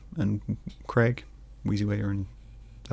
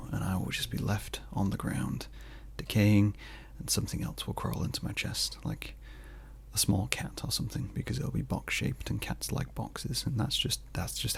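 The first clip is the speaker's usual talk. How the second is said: whispered, much softer dark joke; hushed aside; 10 dB quieter than usual. Real, not acted. Vlogger whispering a morbid joke about his own death. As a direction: drop to a whisper for the grim punchline. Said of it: "mock-morbid aside".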